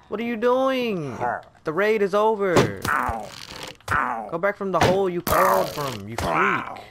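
high, loud outburst at an intruder; indignant yelling. Real, not acted. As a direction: yell at it to get lost. Scolds mob after raid ended.

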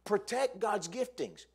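high, slow, forceful charge; urgent pulpit command; preaching exhortation. Real, not acted. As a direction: proclaim it loudly to the congregation.

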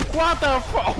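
loud, high yelp of disbelief; startled, cut off mid-word; shocked outburst. Real, not acted. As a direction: burst out in real shock. Shocked at getting knocked down.

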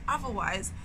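plain reading voice

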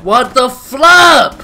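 loud outburst. Real, not acted.

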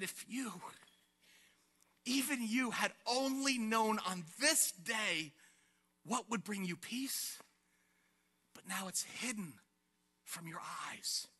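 soft, halting lament with long pauses; sorrowful. Acted. Preacher quotes Jesus weeping over Jerusalem, sorrowful. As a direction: grieve gently over the city. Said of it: "wept over it".

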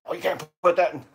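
high-pitched mock alarm; freaked-out naysayer. Boat builder imitating worried people online, freaked out. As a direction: imitate someone fussing in a mild panic. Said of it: "People are get really freaked out".

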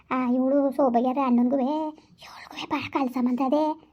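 slow, hushed whisper of a secret; a conspiratorial village whisper; gossipy whisper. Acted. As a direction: lean in and whisper the scandal. Sharing gossip with neighbours.

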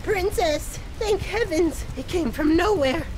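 high, frightened report; panicked relief. Acted. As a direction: gasp out news of an attack to the princess. Frightened islander reports attack.

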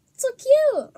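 high, loud gush; delighted cooing. Real, not acted. Vlogger shows viewers the little toys, high delighted gushing. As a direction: coo over something cute in a high voice.

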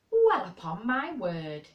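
loud, slow Yorkshire exclamation; astonished broad Yorkshire. A narrator's reading. Housekeeper overhearing children's laughter, astonished broad Yorkshire. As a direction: draw it out in amazed Yorkshire. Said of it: "speaking rather broad Yorkshire herself".

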